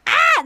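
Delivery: short high mock yell; sudden squawk, imitating a castmate; imitated outburst. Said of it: "And then Genevieve is like".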